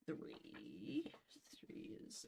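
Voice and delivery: soft voiceless mutter of a number; murmured page math under the breath; whispered counting. Real, not acted. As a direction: whisper the digits to yourself while checking.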